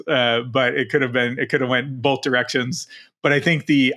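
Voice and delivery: ordinary talk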